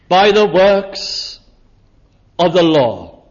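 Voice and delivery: slow, weighty phrases with long pauses; emphatic declaration. Real, not acted. Preacher pressing his text on the congregation, slow and emphatic.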